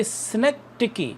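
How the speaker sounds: synecdoche as "si-NEK-tik-ee", soft d, no ch sound; teacher demonstrating synecdoche; model pronunciation. Shown as a demonstration. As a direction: say synecdoche clearly for students to repeat. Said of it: "तो आप इसको पढ़ेंगे".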